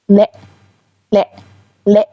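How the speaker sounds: "ل" as a very short "le", cut off at once; very short syllable. Shown as a demonstration. Teacher showing learners the word for "and", "ل" said very short. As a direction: say "ل" with a tiny short vowel.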